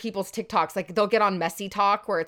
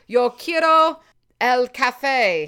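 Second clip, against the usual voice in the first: put-on heavy American accent on Spanish, higher and louder, stretched out slow; bad gringo Spanish accent; 5 semitones higher, 10 dB louder, much slower than usual. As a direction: read Spanish like a tourist who just started Duolingo, slow. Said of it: "We gotta work on your accent".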